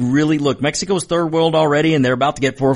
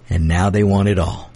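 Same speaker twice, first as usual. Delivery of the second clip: ordinary talk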